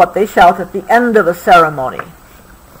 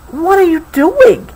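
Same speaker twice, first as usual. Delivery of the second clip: puzzled question; 9 semitones higher than usual. A narrator's reading. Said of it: "asked Sarah".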